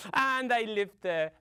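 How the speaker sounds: ordinary delivery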